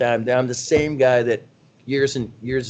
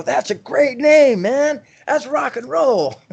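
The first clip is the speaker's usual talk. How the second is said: high, slow mock rocker-dude voice; mock cocky rocker; 13 semitones higher, 4 dB louder, slower than usual. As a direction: ham up the know-it-all rock guy. Retelling his cocky younger self.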